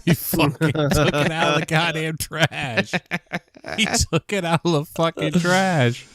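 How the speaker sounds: exasperated. Real, not acted.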